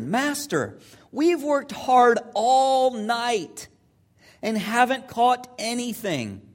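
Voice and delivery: loud, drawn-out weary protest; exasperated Simon. Acted. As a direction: stretch it out high and loud, fed up. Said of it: "you can hear it in his voice".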